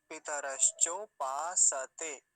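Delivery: पितरश्चो पासते slow and loud, sa separate; full sa. Shown as a demonstration. Teacher repeating the correct form, slow full sa. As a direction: space out paa, sa, te in पासते. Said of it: "सकार को पूरा रखेंगे".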